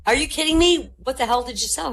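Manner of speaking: high, loud disbelief; high loud shocked manager; astonished boss. Acted. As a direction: shout it like a stunned boss. Boss astonished at huge sale.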